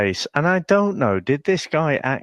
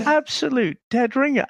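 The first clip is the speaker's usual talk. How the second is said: amazed, pitched right up; incredulous. Acted. Host insisting on the lookalike, incredulous.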